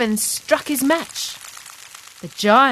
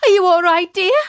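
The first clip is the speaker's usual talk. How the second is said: calling up; 8 semitones higher, 4 dB louder than usual. A narrator's reading. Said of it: "then called up to Molly".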